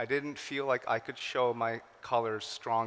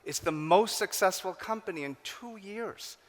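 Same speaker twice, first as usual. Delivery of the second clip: much higher, astonished exclamation; amazed; 7 semitones higher than usual. Retold or imitated. Everyone amazed the failing company became a success, raised in astonishment. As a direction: gush with amazement at the turnaround. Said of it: "the whole world said, "깜짝 놀랐어요".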